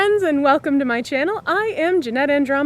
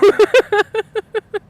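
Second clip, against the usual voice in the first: high giggle; 9 semitones higher, 6 dB louder than usual. Real, not acted. Laughing at own wheezing.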